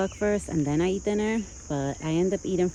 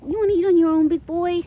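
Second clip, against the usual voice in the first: excited pet talk; 11 semitones higher, 9 dB louder than usual. Real, not acted. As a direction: hype him up to eat alone. Cheering horse to eat.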